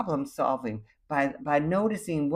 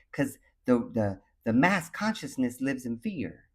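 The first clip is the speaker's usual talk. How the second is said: normal talking voice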